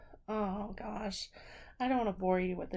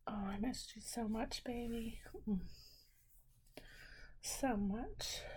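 soft whisper; 3 semitones higher, 6 dB quieter than usual. Acted. Tender reunion in bed.

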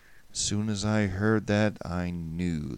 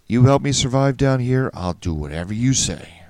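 louder, higher start, urgent; understated desperation, pushed out louder; quick, desperate plea; 5 semitones higher, 10 dB louder than usual. A narrator's reading. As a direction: bargain urgently for survival. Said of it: "I said quickly, hoping he'd read desperation".

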